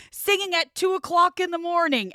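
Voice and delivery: high-pitched mock indignation; outraged roommate. Storyteller imitating the roommate's morning complaint, outraged. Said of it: "he goes".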